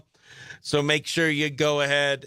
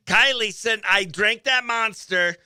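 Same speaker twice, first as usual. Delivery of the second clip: ordinary talk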